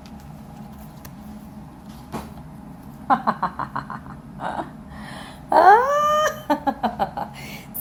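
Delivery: long laugh rising into a high squeal; real laughing fit. Real, not acted. Reader laughs at the card that finally came out, high laughing fit. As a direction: break into breathy laughter, then squeal high. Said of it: "I'm not laughing".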